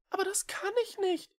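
high, fast plaintive cat whine; whining. A narrator's reading. Echo pleading with the witch, high whiny protest. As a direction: whimper the protest. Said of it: "jammerte Echo".

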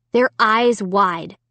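usual speaking voice

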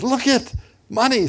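high, loud, delighted exclamation; excited find. Retold or imitated. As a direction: call out in excitement at a lucky find. Retelling wife spotting money on the ground.

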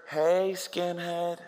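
slow, drawn-out call; a hint of a teasing queen calling; lightly voiced queen drawling his name. Queen calling out from behind the fence.

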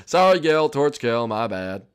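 ordinary talk, nothing marked

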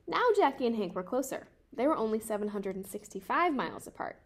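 ordinary delivery, nothing marked

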